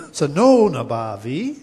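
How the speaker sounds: "Sanonabhavi?" with a rising question tune at the end. Shown as a demonstration. Teacher showing the gopi's doubting tone, rising question. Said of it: "There's an intonation there".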